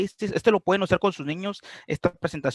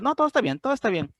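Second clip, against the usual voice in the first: defensive chirpy denial; 8 semitones higher, faster than usual. Acted. Defensive reply acted.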